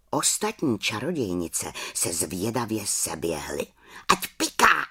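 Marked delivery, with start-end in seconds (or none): 4.1-4.9 high mocking witch squeal; shrill chorus of gloating witches. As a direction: jeer it like a pack of witches. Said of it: "vřískaly".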